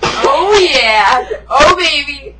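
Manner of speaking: loud, hammed-up ecstasy; exaggerated, playful love-scene shouting; mock passionate cries. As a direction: belt out fake moans of delight. Mock moaning about the guys.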